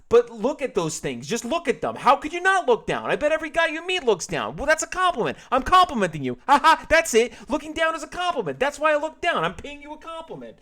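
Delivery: very high, loud wheedling excuses; desperate justifying. Acted. George excusing himself to the cashier, shrill desperate wheedle. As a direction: talk fast to dig out of the hole.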